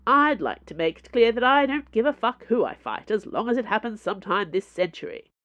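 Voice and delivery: loud, impatient declaration; loudly. A narrator's reading. Doncaster demands the duel finally happen, loudly. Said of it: "said Doncaster loudly".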